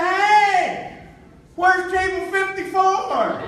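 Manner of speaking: loud, high, slow holler; triumphant call from the stage. Retold or imitated. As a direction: shout it across a packed theater. Calling out to four thousand people.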